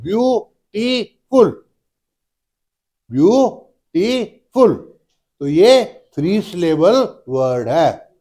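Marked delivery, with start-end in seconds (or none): 0.0-4.7 "ब्यूटीफुल" in three slow, separate beats, ब्यू-टी-फुल; teacher sounding out "ब्यूटीफुल" slowly, syllable by syllable; split into syllables. Shown as a demonstration. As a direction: break "ब्यूटीफुल" as ब्यू... टी... फुल, each beat higher pitched. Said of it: "ब्यूटीफुल में तीन sound आ रही हैं".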